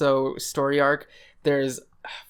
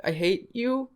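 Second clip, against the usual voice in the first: high, soft, apologetic stammer; a dominatrix too nice to be mean; timid dominatrix; 4 semitones higher, 3 dB quieter than usual. Retold or imitated. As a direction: stumble over the apologies like a shy girl. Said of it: "she's like".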